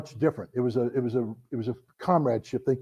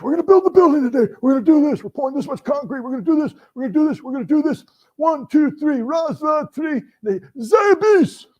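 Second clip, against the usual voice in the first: much higher, louder barked pep talk; rousing Russian commander; 12 semitones higher, 5 dB louder than usual. Retold or imitated. Chernyenko rallying his troops, high loud chant. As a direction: fire up the construction crew like a drill leader.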